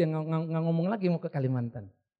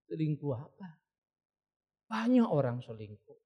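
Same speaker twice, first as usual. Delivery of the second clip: low-key, lower-pitched reply; unimpressed counsellor; 4 semitones lower than usual. Retold or imitated. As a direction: play down the caller's drama. Counsellor answers hysterical caller calmly. (menanggapi datar dengan nada rendah)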